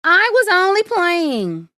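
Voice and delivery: high, loud gleeful teasing; laughing. A narrator's reading. Boy owning up to angry villagers, gleefully mocking. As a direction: sing it out, pleased with the prank. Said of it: "laughed Peter".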